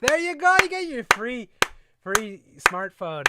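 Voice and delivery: high, loud mock cheer; mock excitement. Mocking the free smartphone giveaway, high sarcastic cheer.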